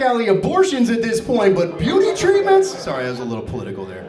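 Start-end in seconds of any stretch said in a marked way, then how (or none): 1.5-2.7 high, disbelieving question; acted indignant outburst, rising at the end; incredulous outrage. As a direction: pitch it up in shocked disbelief. Same disbelief repeated.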